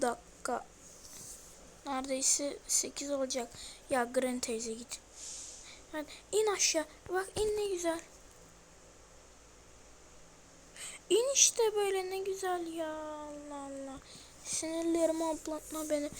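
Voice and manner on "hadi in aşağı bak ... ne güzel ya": higher coaxing sing-song. Real, not acted. Gamer luring Granny downstairs, sweet coaxing. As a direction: sweet-talk her down the stairs.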